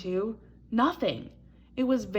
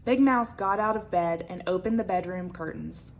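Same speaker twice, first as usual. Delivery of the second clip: ordinary delivery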